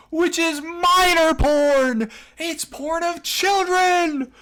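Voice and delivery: very high, loud, drawn-out yell; outraged shriek. Real, not acted. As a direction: scream it in disbelief. Outraged outburst at what he reads.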